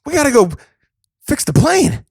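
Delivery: rally the passengers like a B-movie hero; mock action hero. Friends riffing, he plays the star as the plane drops. Playing Wahlberg bit.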